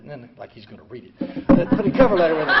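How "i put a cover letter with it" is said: louder, faster, laughing through it; laughing repeat. Real, not acted. Telling the audience about his letter, laughing. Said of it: "and I put a cover letter with it".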